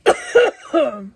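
real choking coughs, loud and sharp; choking cough on pepper dust; harsh cough. Real, not acted. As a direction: cough hard, like it went down the wrong way. Choking on hot pepper candy.

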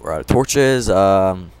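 ordinary talk, nothing marked

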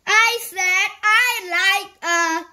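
slow, high, loud shout cut short. A narrator's reading. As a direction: bellow it slowly, then break off. Shouted reply continues, then breaks off.

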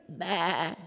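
a pathetic little baa; weak sheep bleat. Acted. The sheep lion tries to roar but bleats softly. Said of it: "he gets up and he goes".

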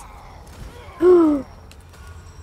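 high, loud startled gasp. Real, not acted. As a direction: gasp sharply in shock. Startled during gameplay.

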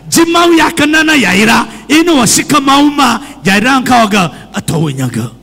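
usual speaking voice

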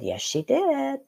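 inner voice. Retold or imitated. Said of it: "And my voice said".

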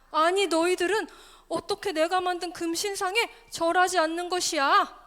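loud, indignant storybook king; scolding king. Acted. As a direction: confront them as an offended king. King scolds the three friends. (호통치는 왕처럼 큰 소리로)

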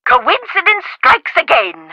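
ordinary talk

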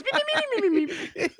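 very high, squeaky mimicking babble; Muppet impression. Friend mimicking the guy in the Muppets, high squeaky babble. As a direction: rattle it off in a high puppet squeak. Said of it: "that guy in the Muppets".

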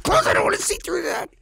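fast, high frantic squeal; panicked high plea. Retold or imitated. Scared flyer repeating his plea to his wife, fast and high. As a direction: blurt it out in a frightened rush.

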